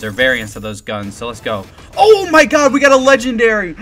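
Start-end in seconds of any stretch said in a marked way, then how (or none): 1.9-3.8 high, loud cry of disbelief; real shock and joy, pitched way up; excited outburst. Real, not acted. Sudden rare gun pull.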